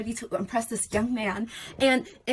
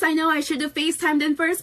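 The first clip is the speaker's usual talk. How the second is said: usual speaking voice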